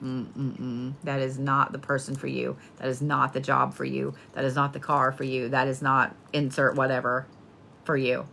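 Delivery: a patient voice shutting every door; universe saying no. Pretend. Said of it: "when the universe is like".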